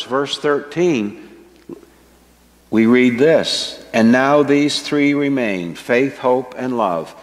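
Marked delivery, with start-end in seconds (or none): none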